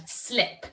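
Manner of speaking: slip, short I sound. Shown as a demonstration. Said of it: "It's got that I sound".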